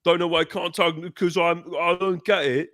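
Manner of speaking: mock a friend with an over-the-top British accent; fake British accent. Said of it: "doing a fake British accent".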